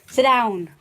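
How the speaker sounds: loud firm command; real loud order to the dog; yelling at the dog. Real, not acted. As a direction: bark the order to sit. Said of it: "I'm yelling at the dog".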